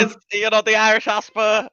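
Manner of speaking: high-pitched mock Irish accent that slips towards English; attempted Irish accent. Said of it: "Sounding English there, lad".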